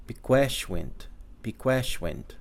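piqueshwint stretched slowly and clearly for learners; slow demo. Shown as a demonstration. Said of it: "Girl is".

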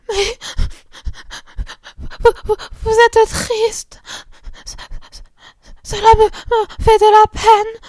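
high, slow, choking sobs through the words; a little girl crying so hard she can barely speak; sobbing child. A narrator's reading. Little Marguerite sobbing to her sisters, high and choked.

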